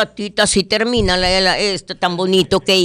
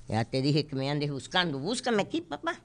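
soft scolding; 4 semitones lower, 14 dB quieter than usual. Real, not acted. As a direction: scold softly so mom won't complain. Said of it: "mira cómo te estoy hablando".

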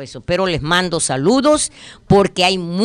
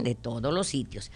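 quiet repeat; 7 semitones lower, 13 dB quieter than usual. Real, not acted.

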